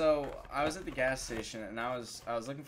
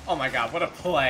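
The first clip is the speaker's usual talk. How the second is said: excited; 9 dB louder than usual. Real, not acted. Reacting to a great goal.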